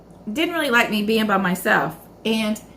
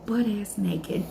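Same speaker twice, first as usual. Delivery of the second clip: ordinary talk, nothing marked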